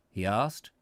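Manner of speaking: ordinary delivery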